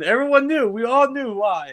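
high, loud emphasis; worked-up emphasis on the scene; excited. Real, not acted. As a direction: push it up with feeling. Emotional emphasis.

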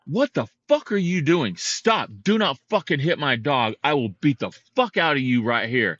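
high-pitched, hard-hitting angry rant; retold furious outburst. Dog owner threatening the man who swung at his dog, retold furious outburst. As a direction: re-enact losing your temper at someone. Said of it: "I lost it".

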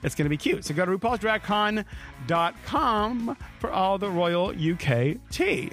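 ordinary talk, nothing marked

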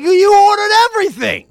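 high, loud accusing shout; angry waiter. Retold or imitated. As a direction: throw the blame at them loudly. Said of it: "He's like".

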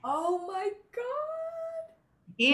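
high, loud, drawn-out astonishment; floored listener's long, loud exclamation; real amazement. Real, not acted. Amazed reaction to the story.

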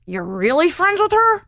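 mock disbelief, pitch climbing high, drawn out; slow, high-pitched retold double-take; incredulous question. He questions the speaker's claim of friendship, incredulous.

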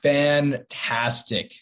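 very slow, stretched-out delivery; drawn-out word. Pretend. Answering how the weekend was, drawn out. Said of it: "leave us with the fantastic".

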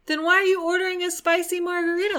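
high, fast disbelief; baffled outburst; incredulous. Real, not acted. Incredulous rant.

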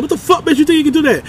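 fast, high-pitched mock scolding; imitating yelling doctor. Doctor scolding the injured climber, quick mock yell. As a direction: rattle it off in high outrage. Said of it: "he said, just like that".

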